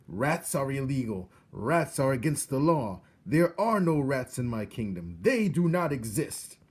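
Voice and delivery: loud high royal shout. A narrator's reading. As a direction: shout with hands over your ears. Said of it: "he shouted".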